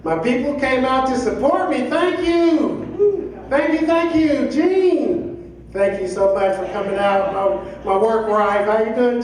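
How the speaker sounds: ordinary talk, nothing marked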